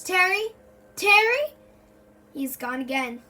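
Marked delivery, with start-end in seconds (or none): 0.0-1.5 rising, louder calls of a name; calling out anxiously. Acted. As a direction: call his name, louder each time. Searching for vanished friend.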